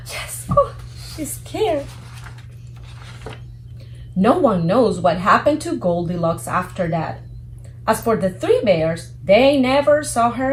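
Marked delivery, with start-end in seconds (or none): none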